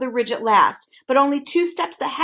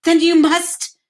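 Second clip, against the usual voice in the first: gasped; 7 semitones higher, 8 dB louder, slower than usual. A narrator's reading. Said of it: "gasped Milo".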